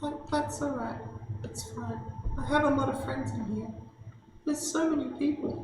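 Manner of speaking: very high, far-off calling voice; psychic sister voice. Acted. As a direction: call out as the lost sister from inside the brain. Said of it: "[name] sounds weird".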